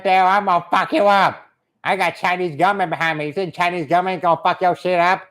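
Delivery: put-on caricatured Chinese accent, indignant threats; offended Chinese man. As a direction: rant as a cartoon Chinese tough guy. Plays offended Chinese husband.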